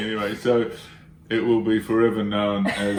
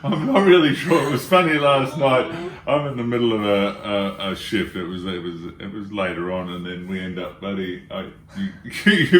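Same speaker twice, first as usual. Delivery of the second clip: ordinary talk, nothing marked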